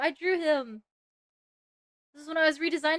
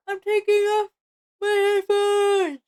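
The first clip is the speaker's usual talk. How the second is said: loud; 7 semitones higher, 7 dB louder, slower than usual. Real, not acted. Loud ads hurting her ears.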